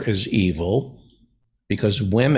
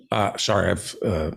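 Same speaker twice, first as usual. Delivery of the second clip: talking through food; words round a bite of food; mouth full; 4 semitones lower, 4 dB quieter than usual. Real, not acted. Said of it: "food in my mouth".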